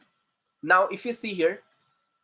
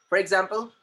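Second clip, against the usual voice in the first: ordinary talk, nothing marked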